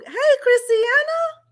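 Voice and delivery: high, drawn-out hey, voice welling up; emotional greeting. Real, not acted. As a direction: greet her as if about to cry with joy. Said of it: "y'all know I get all emotional".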